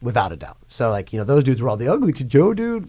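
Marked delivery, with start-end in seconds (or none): 1.8-2.8 mocking. Mocking what bandmates called him.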